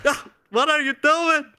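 rising high panicked yells at the screen; desperate mock pleading. Gamer yelling at game character not to leave, mock panic.

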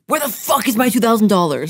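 loud retold demand for money; re-enacted irate phone demand; angry caller.

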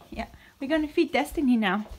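usual speaking voice

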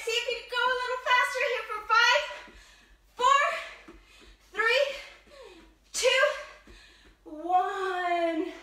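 fast, high and loud push; urgent hype. Real, not acted. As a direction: rush them through the last reps.

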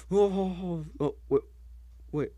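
normal talking voice

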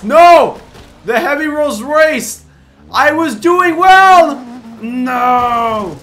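loud, high, drawn-out wail; howling as the lead slips away; frustrated yelling. Real, not acted. Pushed off track by car.

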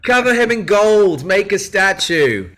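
high, loud, fervent praise; excited cheer. Real, not acted. Actor hails his fellow actor Brian Bloom, loud excited cheer. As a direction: cheer him like a devoted fan.

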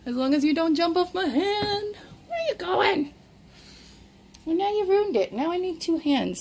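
high-pitched pet-talk scolding; playful pet scolding. Real, not acted. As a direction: chide your pet in a high voice.

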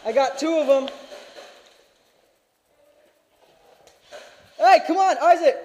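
high, loud excited yell; hollering to a teammate; excited shouting. Real, not acted. Excited calls across field.

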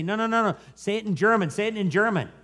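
high, urgent interruption, acted out; imitating an exasperated translator; insistent correction. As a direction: cut in and insist.

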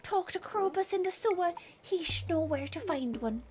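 soft Irish instruction; Irish accent. Acted. Wizard instructing the player, soft Irish accent. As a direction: explain quietly in an Irish lilt. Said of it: "I don't know why they're Irish".

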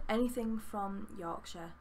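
normal talking voice

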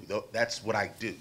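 normal talking voice

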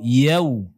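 "yau" closing on a rounded w; full waw. Shown as a demonstration. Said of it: "tetapi dengan waw".

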